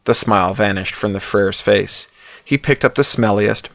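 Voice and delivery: ordinary delivery, nothing marked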